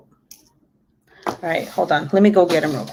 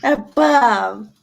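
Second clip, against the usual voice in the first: high singsong play words; 7 semitones higher than usual. Real, not acted. Playing with the baby.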